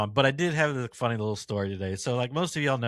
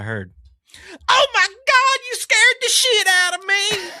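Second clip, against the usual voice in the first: high, loud startled shriek; screaming lady; 9 dB louder, slower than usual. Retold or imitated. Startled customer shrieks at the clerk, high and loud. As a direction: shriek it like a scared woman. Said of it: "that's how she says it".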